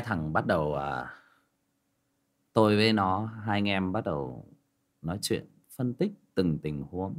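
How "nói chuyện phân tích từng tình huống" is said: normal talking voice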